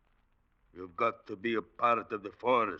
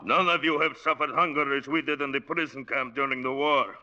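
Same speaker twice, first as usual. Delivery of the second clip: loud, higher, rising anguish about hunger; bitter outburst; 6 semitones higher, 5 dB louder than usual. Acted. Son erupts about prison camp hunger, bitter and loud. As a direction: let the old pain burst out.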